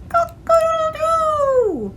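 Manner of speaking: very high, loud, slow rooster crow. Acted. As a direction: crow like a rooster waking the world. Rooster crows for ghost.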